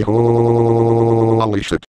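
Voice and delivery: a long, wondering Holy shit; drawn-out amazement. Pretend. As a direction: drag out Holy shit in awe. Exclaims at a time paradox.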